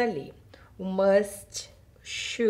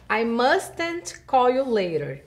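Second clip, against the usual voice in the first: "I mustn't call you later" read slowly; slow model sentence; 2 semitones higher, 3 dB louder, much slower than usual. Shown as a demonstration. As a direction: read the example slowly for learners. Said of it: "Olha os exemplos do slide".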